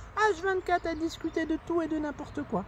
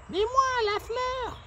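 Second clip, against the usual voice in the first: a lightly voiced flower, pitched higher; flower's voice; 7 semitones higher, much slower than usual. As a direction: chirp it as the little flower. The flower introduces herself.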